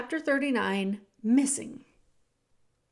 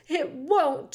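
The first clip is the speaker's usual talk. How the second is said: higher, tearful protest; 5 semitones higher, 4 dB louder than usual. A narrator's reading. Cook refusing Louise's comfort, tearful protest. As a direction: protest through narrated tears. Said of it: "to wipe at her tears".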